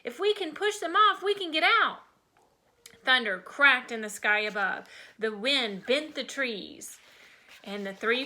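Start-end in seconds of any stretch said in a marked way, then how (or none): 0.0-2.0 put-on high child's voice, urging friends on; rally trapped friends, pitched high; urgent high-pitched call; loud urging. A narrator's reading. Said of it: "Freddie said loudly".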